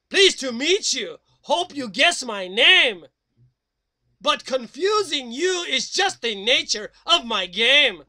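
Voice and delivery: loud, theatrical devil reciting. Acted. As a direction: proclaim it like Lucifer himself. Speaks as the Devil.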